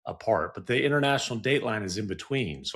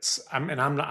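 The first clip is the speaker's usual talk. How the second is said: usual speaking voice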